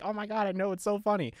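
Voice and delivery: a hint of mom giggling along; amused mom. Mom to son about the boys' messages, amused.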